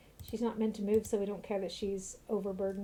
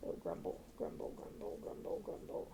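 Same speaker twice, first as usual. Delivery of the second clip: whispered grumbling; 13 dB quieter than usual. Acted. Old man whispering grumbles to himself.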